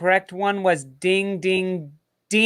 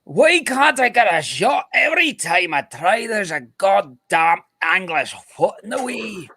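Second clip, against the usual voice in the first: put-on high, shouted Scottish accent, more like Irish; bad Scottish accent; 5 semitones higher than usual. Quiz host playing a footballer blocked by English defenders, bad Scottish accent. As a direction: wail it in a hammed-up Scottish accent, easing down. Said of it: "more like an Irish accent than a Scottish accent".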